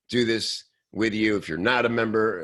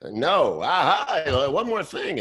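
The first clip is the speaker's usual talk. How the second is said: high, louder sing-song sales voice; pushy upseller; 8 semitones higher, 4 dB louder than usual. Retold or imitated. Marketer mocking yet another upsell email, high and bright. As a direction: barge back in with fake cheer.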